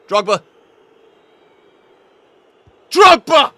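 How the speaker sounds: a loud, high cry of the name; exasperated yell. Real, not acted. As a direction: build from a call to a full yell. Opponent's Drogba scores again.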